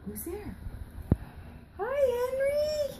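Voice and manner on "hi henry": high-pitched greeting. Pretend. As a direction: sing the name out in a little high voice. Greeting for child, high take.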